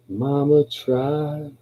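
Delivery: long, high stretched vowels; drawn-out quote. Acted. Recalling that Mama tried, drawn out high.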